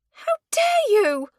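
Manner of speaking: very high, loud, slow outraged cry. A narrator's reading. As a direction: fling it back in shock. Spinster outraged at accusation.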